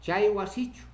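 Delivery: loud, high "Chay wasichu" as a question; questioning tune. Shown as a demonstration. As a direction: ask "Chay wasichu" emphatically, rising. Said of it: "Con eso podemos interrogar".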